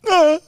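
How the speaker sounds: expressive "uh" as an answer; inflected grunt. Shown as a demonstration. Asked if he still loves his wife, inflected grunt. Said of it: "the inflection you put on it".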